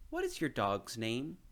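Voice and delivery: usual speaking voice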